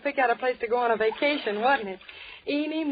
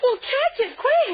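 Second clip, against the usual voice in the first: panicked urging; 9 semitones higher, 3 dB louder than usual. Acted. Urging friend to grab boat.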